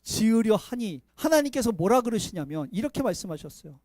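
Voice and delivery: ordinary delivery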